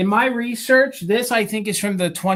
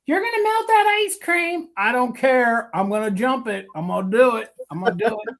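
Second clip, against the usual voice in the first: Southern pixie; 4 semitones higher than usual. Pretend. Seller voicing the Kewpie leaping the ice cream, Southern pixie voice. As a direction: voice the mischievous doll in a Southern pixie accent. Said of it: "he's a Southern pixie".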